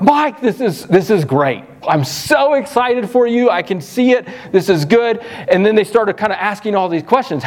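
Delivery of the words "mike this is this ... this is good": high, delighted congratulations with a laugh; a relative calling to congratulate on the new church; excited relative. Retold or imitated. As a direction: gush about the news. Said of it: "they're like".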